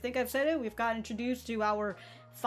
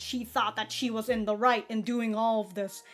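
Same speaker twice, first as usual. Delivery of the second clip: normal talking voice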